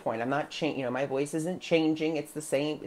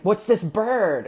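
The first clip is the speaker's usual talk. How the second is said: higher and louder curious question; amazed question; 7 semitones higher, 7 dB louder, slower than usual. Retold or imitated. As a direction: ask it wide-eyed. Asking the floating guide, amazed.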